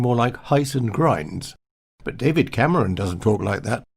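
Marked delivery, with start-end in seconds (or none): 0.4-1.5 put-on slow old-posh vowels, "heis and grinds"; exaggerated upper-class English vowels; old posh accent. Shown as a demonstration. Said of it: "posh pronunciation of house and grounds".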